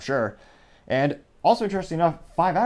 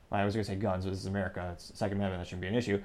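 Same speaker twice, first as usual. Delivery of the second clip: normal talking voice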